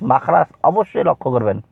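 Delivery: usual speaking voice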